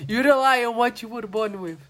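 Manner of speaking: put-on over-the-top Italian accent. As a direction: recite it like a diva with an Italian accent. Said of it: "sotaque horroroso da [name]". (sotaque italiano teatral)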